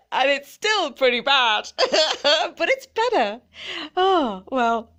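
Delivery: laughing through the words. Real, not acted. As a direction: giggle as you admit it. Laughing while admitting.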